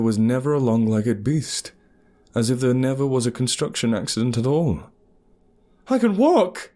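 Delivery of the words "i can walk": high, loud joyful shout; joyful yell. Acted. Narrator shouting to the huntress while sprinting, joyful yell. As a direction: yell it out with pure joy. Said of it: "I yelled".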